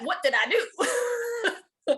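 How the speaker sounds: high, drawn-out, half-laughing what did I do; exaggerated baffled protest. Retelling a boyfriend fight, baffled drawn-out laughing protest. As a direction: stretch it out in mock disbelief, almost crying with laughter.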